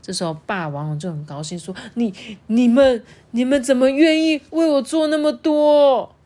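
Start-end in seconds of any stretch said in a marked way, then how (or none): 2.0-6.1 happy tears. Acted. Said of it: "他喜极而泣".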